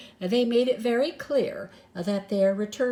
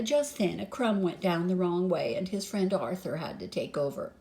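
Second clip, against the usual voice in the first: ordinary delivery, nothing marked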